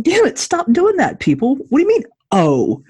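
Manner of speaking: high exasperated outburst; mock exasperation at doctors.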